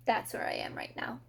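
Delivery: fast, voiceless whisper. Real, not acted. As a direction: whisper it like a secret. Whispered aside to the camera.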